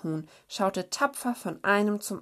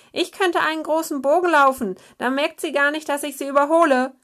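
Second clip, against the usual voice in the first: high, fast, uneasy bravado; a little hare talking himself into courage; nervous snow hare; 9 semitones higher, 9 dB louder, faster than usual. A narrator's reading. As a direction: brave words over a jittery nerve. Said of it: "wie mulmig ihm war".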